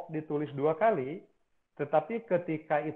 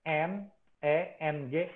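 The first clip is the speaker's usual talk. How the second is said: letters "N E N G" slow and apart; spelled out; 2 semitones higher, slower than usual. Shown as a demonstration. Teacher spells the name out slowly. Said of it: "Ditulis pakai E".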